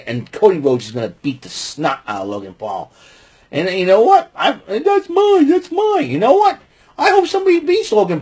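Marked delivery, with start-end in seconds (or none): 3.5-8.2 high, mocking whiny voice up top. Mocking Logan Paul claiming the title, whiny squeak. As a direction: squeak it like a bratty kid.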